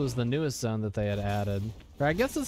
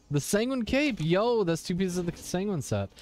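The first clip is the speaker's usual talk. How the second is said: light up at the find; excited; 7 semitones higher than usual. Real, not acted. Excited about rare loot.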